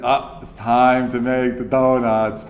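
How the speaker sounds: slow, droning just-woken imitation; uninspired monotone. Donut man waking to his alarm, slow uninspired monotone. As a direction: drag it out on one note. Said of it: "And it's, like, a monotone".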